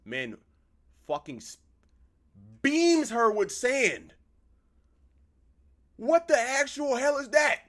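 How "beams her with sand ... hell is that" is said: high, loud yell of disbelief; shocked outburst. Real, not acted. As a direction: shout it out in stunned disbelief. Shocked at game event.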